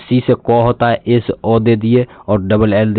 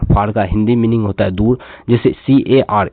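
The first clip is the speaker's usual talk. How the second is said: ordinary talk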